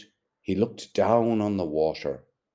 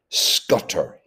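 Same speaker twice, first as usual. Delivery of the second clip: a thick, louder cry of the word; cried thickly; 3 semitones higher, 7 dB louder than usual. A narrator's reading. Buck Mulligan cries out thickly at Stephen. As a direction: blurt it out thickly, like a curse. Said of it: "he cried thickly".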